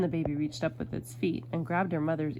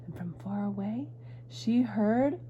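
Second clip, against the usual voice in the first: ordinary delivery, nothing marked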